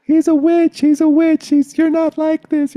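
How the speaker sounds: very high, loud imitated screaming; screaming mob. Brothers banging on the door at night, imitated screaming. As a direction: shriek it like an enraged drunken mob. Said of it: "they were screaming things like".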